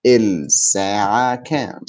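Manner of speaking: "الساعة كام" syllable by syllable with long vowels. Shown as a demonstration. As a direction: draw out every part of "الساعة كام" for a learner. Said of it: "How do you say".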